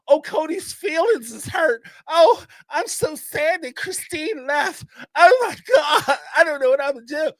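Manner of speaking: high, wailing fake sob; mock crying Robin. Mocking Robin's tears over Cody, high fake wailing. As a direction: ham up a whiny cry. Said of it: "you talking about how you feel?".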